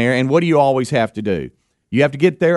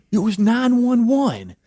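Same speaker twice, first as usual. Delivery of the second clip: put-on teen son; 10 semitones higher, slower than usual. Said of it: "He goes".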